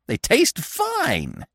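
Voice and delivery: very high, long-stretched relish; high glee. A narrator's reading. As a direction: savour it slowly in a high bird voice. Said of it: "said the woodpecker in high glee".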